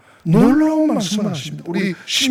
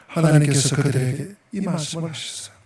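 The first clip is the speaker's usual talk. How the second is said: normal talking voice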